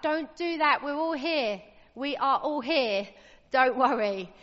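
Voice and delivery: loud urgent call to stop him; crying out. Acted. Prisoners shouting to the jailer not to kill himself, loud and urgent. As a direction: shout it to save a man's life.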